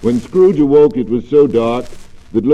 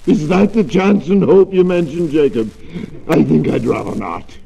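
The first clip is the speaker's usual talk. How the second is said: anxious; 5 semitones higher, 5 dB louder than usual. Acted. Scrooge dismayed at the news.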